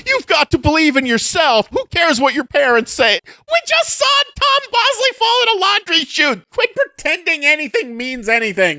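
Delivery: hammed-up encouraging girlfriend; earnest pep talk turning shrill; mock pep talk. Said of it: "And she's like".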